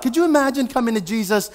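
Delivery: usual speaking voice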